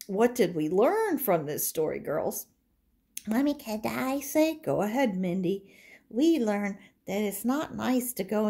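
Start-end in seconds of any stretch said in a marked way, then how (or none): 3.3-4.5 put-on high little girl voice; a doll daughter asking her mother, in a high voice. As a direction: pipe up like an eager small girl.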